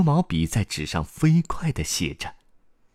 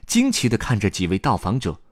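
plain reading voice

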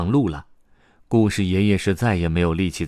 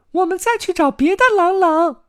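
very high, loud tiny-character voice; rally friends in a squeaky little voice; 7 dB louder than usual. A narrator's reading. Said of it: "卡门说". (尖细高亢地给同伴打气)

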